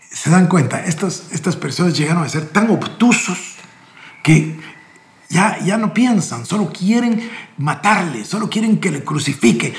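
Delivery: fervent preacher's voice; preaching. Real, not acted. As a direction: press the point home to the congregation. Impassioned commentary on the reading.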